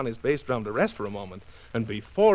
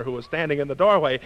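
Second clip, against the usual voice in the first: ordinary delivery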